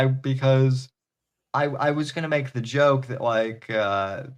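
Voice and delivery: ordinary talk, nothing marked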